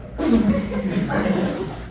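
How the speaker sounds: loud breathy laugh breaking the story; big real laugh; burst of laughter. Real, not acted. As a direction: laugh out loud mid-sentence.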